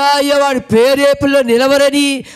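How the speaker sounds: normal talking voice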